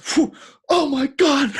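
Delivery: high, slow, loud outcry; hammed-up, drawn-out high exclamation; mock shock. Re-enacts shock after the spider.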